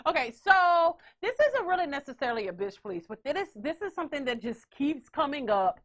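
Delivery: normal talking voice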